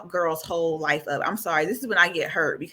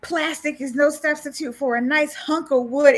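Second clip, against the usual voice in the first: higher, indignant doll rant; angry Tiffany; 6 semitones higher than usual. Retold or imitated. Tiffany yelling at Chucky over the dishes, higher and indignant. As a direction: rant like an offended housewife.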